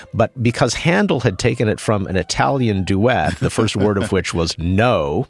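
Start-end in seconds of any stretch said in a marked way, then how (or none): none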